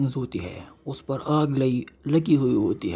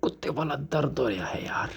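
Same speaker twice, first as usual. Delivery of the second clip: quicker, softer moan of pain; 6 dB quieter, faster than usual. Acted. The hero complains of pain to the ring. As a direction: groan through the pain. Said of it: "एक झटका लगता है".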